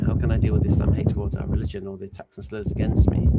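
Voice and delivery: normal talking voice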